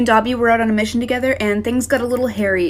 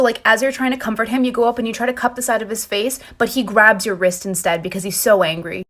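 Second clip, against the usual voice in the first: normal talking voice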